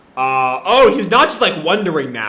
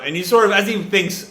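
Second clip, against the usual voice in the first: ordinary delivery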